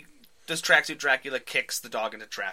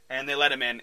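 ordinary talk, nothing marked

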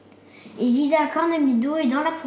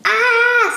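high, loud startled "Ah!"; sudden yelp of fright; startled cry; 10 semitones higher, 8 dB louder than usual. A narrator's reading. Boy jolted awake before the witches, startled cry. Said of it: "s'écria Pierre en sursautant".

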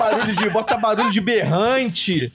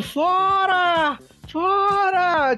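high, stretched mock scream; drawn-out high yell without real volume; imitated screaming; 10 semitones higher, much slower than usual. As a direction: mimic a woman hollering out the window. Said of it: "ficar os berros gritando".

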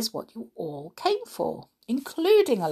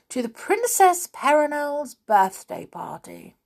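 important voice; 7 semitones higher than usual. Acted. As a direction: announce it grandly to the chair. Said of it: "in a rather important voice".

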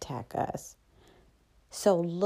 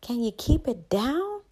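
mock yell, higher and a bit louder; retold yell; 6 semitones higher, 4 dB louder than usual. Woken son yelling at his mother on the phone, mock yell. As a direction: call out through the wall, irritated. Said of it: "I yell in there and say".

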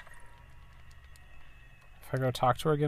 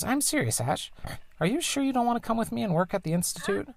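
higher, lightly put-on mother voice; mom's voice; 10 semitones higher than usual. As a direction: play the tinkering engineer mom, a bit higher. Voices the mom.

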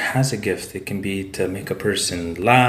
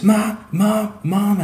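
high-pitched imitated call for a mother; crying out for mom; 12 semitones higher, 3 dB louder, much slower than usual. Storyteller imitates his grieving buddy calling for his mother, high cry. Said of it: "and he's just crying".

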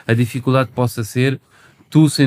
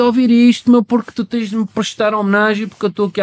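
put-on arrogant old-school rapper; 10 semitones higher than usual. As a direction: puff up like a self-important old-timer. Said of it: "Mais do que".

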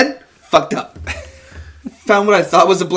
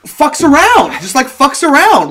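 high, loud "fucks around"; raised-voice insistence on "fucks around"; emphatic correction; 9 semitones higher, 7 dB louder than usual. Real, not acted. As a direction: burst in to correct the guess, loudly. Correcting co-host's "fucks them over.

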